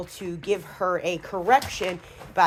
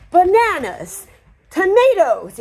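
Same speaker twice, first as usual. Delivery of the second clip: much higher, louder gushing singsong; excited praise tone; 12 semitones higher, 9 dB louder, slower than usual. Shown as a demonstration. Showing the dog only hears tone, gushing nonsense words. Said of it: "the tone of voice and how you say it".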